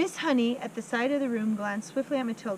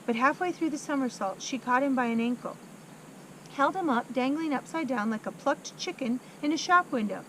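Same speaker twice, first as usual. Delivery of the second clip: usual speaking voice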